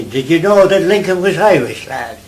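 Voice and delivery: put-on Irish accent. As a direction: imitate a New York Irish kid boasting, Irish accent. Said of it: "all the Irish spoke the same way".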